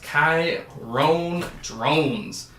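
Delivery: slow, drawn-out "Kyron Drones", stretching every syllable; savoring the name. Shown as a demonstration. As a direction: relish the name, very slowly. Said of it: "Love saying that name".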